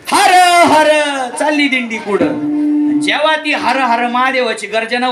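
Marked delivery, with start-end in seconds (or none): 0.1-0.9 a high, loud chant of the procession cry; war cry. Acted. Boys of Gokul marching in procession, loud high war cry. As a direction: shout it like a crowd of boys marching.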